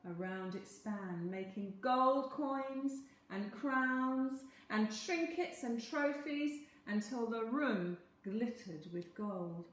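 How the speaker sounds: usual speaking voice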